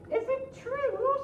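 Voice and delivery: very high, sweet princess; high flattering princess. Acted. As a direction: flatter the dragon in a high girlish voice.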